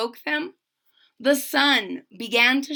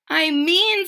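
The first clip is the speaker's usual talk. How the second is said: put-on boy speaking; 6 semitones higher, 4 dB louder than usual. A narrator's reading. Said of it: "said Bartholomew".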